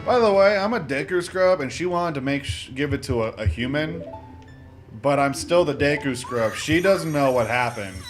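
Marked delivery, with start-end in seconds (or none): none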